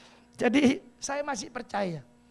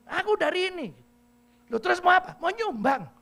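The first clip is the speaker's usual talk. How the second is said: high brash auntie; 10 semitones higher, 6 dB louder than usual. Retold or imitated. Auntie answering the pastor, loud and brash.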